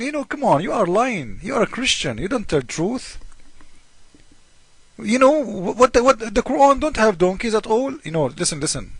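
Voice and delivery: ordinary talk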